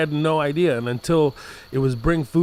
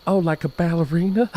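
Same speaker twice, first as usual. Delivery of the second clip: put-on naive kid; 4 semitones higher than usual.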